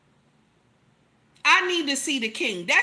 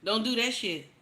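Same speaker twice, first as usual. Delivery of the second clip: ordinary talk, nothing marked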